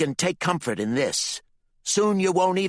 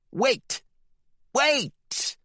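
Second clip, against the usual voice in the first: much higher sudden cry; alarmed shout; 5 dB louder than usual. Acted. The Reverend startled by the readings, alarmed cry. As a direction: stop everything, it's going wrong.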